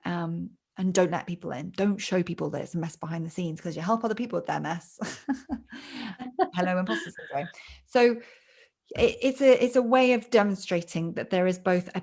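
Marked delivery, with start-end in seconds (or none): 5.0-6.5 amused, self-aware chuckle; short burst of real laughter. Real, not acted. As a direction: laugh lightly at yourself. Laughs at own self-doubt.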